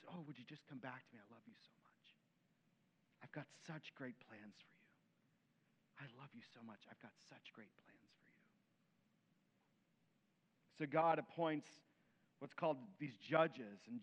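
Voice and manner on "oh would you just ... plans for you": tender whisper. Acted. God tenderly calling people back.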